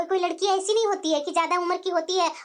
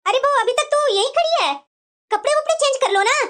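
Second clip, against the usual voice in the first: raised, higher voice hurrying someone along; urging loudly; 6 semitones higher, 6 dB louder than usual. Acted. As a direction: call out to the bride to get moving. Mother-in-law hurrying the new bride. (ऊँची आवाज़ में टोकना)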